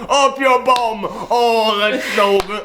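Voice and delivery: high, laughing delight at the joke; retelling a man who can't stop laughing. Retold or imitated. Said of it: "burst out laughing and goes".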